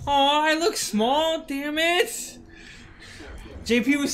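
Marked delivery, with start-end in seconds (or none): 0.1-2.4 high, slow, whining self-pity put on; mock dismay. Retelling being called small, mock dismay. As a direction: play up a pouty little lament.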